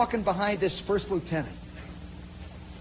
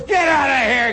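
loud, raised acted shout; angry order; 5 semitones higher, 6 dB louder than usual. Sergeant shoving private out, angry shout. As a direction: roar it as you shove someone away.

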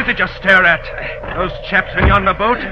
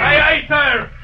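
shouted reply; 8 semitones higher, slower than usual. Acted. Mate answering order over water.

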